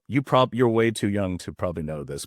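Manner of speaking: normal talking voice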